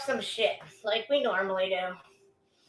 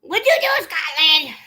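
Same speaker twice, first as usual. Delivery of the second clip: much higher and louder, squealing the question; excited call; 4 semitones higher, 7 dB louder than usual. Real, not acted. Calling out to Scotland in chat, excited and high. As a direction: pitch it way up, eager to know.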